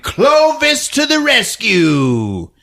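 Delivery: high, slow, drawn-out cry; mock heroic fanfare. A narrator's reading. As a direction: announce it like a cartoon superhero. Heroic announcement of the cat.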